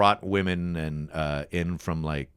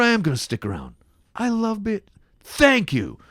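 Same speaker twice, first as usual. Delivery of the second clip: rising to a high, loud thanks; acted gratitude; 5 dB louder than usual. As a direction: build into hammed-up gratitude. Improvised grateful change of heart.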